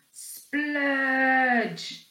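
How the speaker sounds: drawn-out squelchy splurge; splurge sound. A narrator's reading. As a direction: make it sound like spraying foam. Sound effect word.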